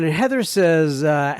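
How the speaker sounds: normal talking voice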